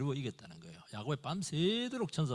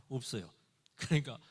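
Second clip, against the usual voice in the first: ordinary delivery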